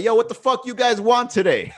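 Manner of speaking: brash boss on the business side; rough business-side persona. Pretend. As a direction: bark it like a boss who stopped caring. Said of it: "be like".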